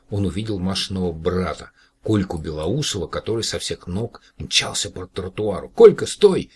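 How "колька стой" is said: much higher and louder call to stop; shout. A narrator's reading. Yura calling to Kolka across the street, loud shout. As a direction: yell after a running boy. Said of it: "крикнул Юра".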